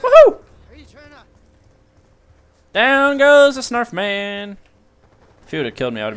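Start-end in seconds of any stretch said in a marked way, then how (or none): 0.0-0.4 very high, loud cheer; victory whoop. Real, not acted. Celebrating a kill. 2.7-4.6 gloating. Real, not acted. Gloating after killing Snarf.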